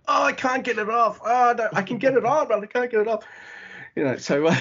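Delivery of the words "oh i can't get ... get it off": high mock whine. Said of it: "when he's like".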